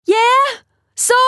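high loud yell back. Acted. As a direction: shout a reply. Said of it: "Anna yelled back".

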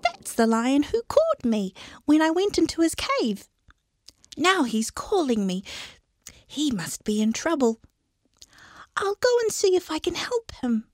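high squeaky voice dropping to a whisper; little mouse hurrying off to help; worried mouse. Acted. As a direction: think it through as an anxious little mouse. Mouse character voice.

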